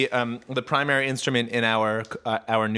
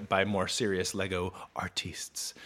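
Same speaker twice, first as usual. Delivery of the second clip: hushed aside; whispering; 4 semitones lower, 7 dB quieter, slower than usual. Real, not acted. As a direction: drop to a whisper. Whispered aside about critics.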